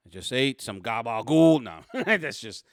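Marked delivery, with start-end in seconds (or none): 0.1-1.6 lightly mocked Italian-American cop, louder. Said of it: "again, more stereotyping".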